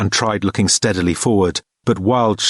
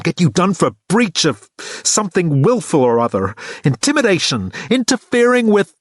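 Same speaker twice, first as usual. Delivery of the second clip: high, scornful rant building; mock him, then snap at the end; sarcastic anger; 6 semitones higher than usual. A narrator's reading. Kate mocking Thor angrily.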